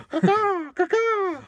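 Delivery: high, loud cawing crow call; crow impression. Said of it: "some kind of Foley artist".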